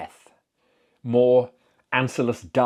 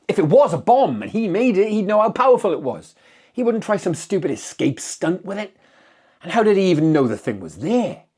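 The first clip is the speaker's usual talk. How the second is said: higher and louder, frustrated and heated throughout; agitated outburst; 6 semitones higher, 6 dB louder than usual. Acted. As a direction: let the frustration boil over. Sheriff vents over the needless deaths.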